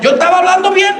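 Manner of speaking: high, rising mock complaint; hammed-up Dominican churchgoer; imitated indignant protest.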